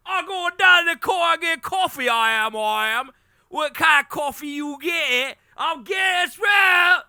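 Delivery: very high, loud British caricature; hammed-up British squawking; mock British accent. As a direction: shout it as an over-the-top Brit. Hammed-up British coffee skit.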